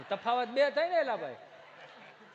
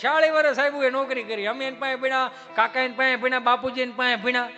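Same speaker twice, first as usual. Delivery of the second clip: higher and much louder proud declaration; proud loud boast; 4 semitones higher, 9 dB louder than usual. Acted. Comedian boasting of his school to the audience: loud and high. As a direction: boast to the hall about the old school.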